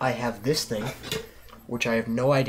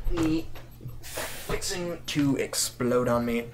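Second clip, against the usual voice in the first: slow complaint; much slower than usual. Real, not acted.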